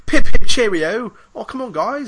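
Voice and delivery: put-on small British boy caricature, very high and loud; Pickle. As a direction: squeal it out like a cartoon British lad. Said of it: "how Americans think British people talk".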